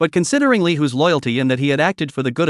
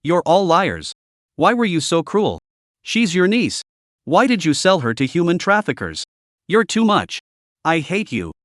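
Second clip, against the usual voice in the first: plain reading voice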